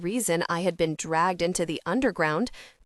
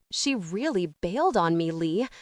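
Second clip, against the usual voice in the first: ordinary delivery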